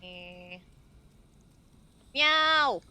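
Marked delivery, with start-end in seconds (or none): 2.1-2.8 loud, high cat meow, falling at the end; big high-pitched meow. Pretend. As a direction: belt out a cat's meow. Player meows as the cat.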